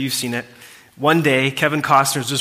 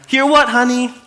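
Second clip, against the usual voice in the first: higher, light and puzzled wife voice; a carefree call back from the porch; 11 semitones higher, 6 dB louder than usual. Retold or imitated. The wife calls back from the porch swing, puzzled.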